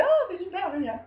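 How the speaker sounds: high-pitched mock excitement; acted delight. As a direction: play a thrilled son, voice jumping up. Voices son delighted at becoming rich.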